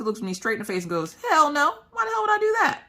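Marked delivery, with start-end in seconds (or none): 1.3-2.8 much higher, put-on voice for the blunt husband; mocking husband voice. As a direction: play the husband as a squeaky cartoon.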